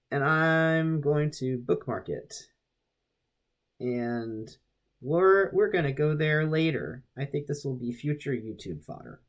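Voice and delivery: ordinary talk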